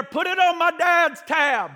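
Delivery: high, slow yell up to the store. Retold or imitated. Boy yells up to store clerk, high slow holler. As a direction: holler the order up the bank. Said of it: "and I'd yell up there".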